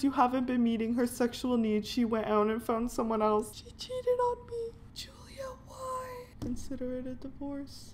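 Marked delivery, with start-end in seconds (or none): 3.5-6.1 high broken whisper; mock heartbroken. Gamer whimpering over the cheating wife, high and hushed. 6.4-7.9 cold hushed whisper. Pretend. Gamer whispering the divorce to his game wife.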